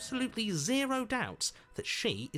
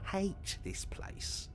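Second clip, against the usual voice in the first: whispered grumble; 8 semitones higher, 6 dB quieter, much slower than usual. Acted. A game voice grumbles.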